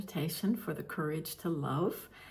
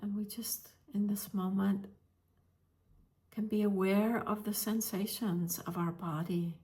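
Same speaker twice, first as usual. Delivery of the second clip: guided meditation. Real, not acted. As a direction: gently guide listeners into stillness, leaving space between phrases.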